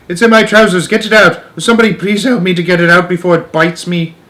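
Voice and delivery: high, loud comic panic; panicked shouting. A narrator's reading. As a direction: shout desperately for someone to help. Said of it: "the stupid cook still shouting".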